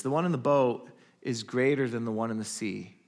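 ordinary delivery

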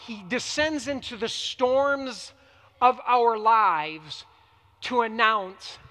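ordinary talk, nothing marked

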